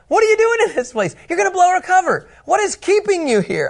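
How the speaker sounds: startled spies. Acted. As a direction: act out the startled spies confronting him. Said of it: "They say".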